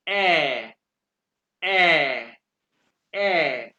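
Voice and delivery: "ए" held long and loud as an open German ä; drawn-out "ए" with a falling tune, repeated; umlaut pronunciation demo. Shown as a demonstration. Teacher shows how A-umlaut sounds, long loud vowel demo. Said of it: "ए को कैसे pronounce करते हैं".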